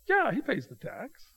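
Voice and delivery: high-pitched acted reply, taken aback; puzzled retort. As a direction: answer as if the question were odd, voice pitched well up. Voicing Peter's answer to collectors.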